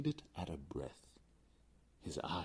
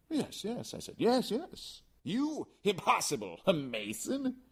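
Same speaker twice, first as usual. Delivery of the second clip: much higher, astonished voice; incredulous; 5 dB louder, slower than usual. Acted. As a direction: disbelieve him openly. Incredulous exchange.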